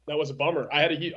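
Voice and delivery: normal talking voice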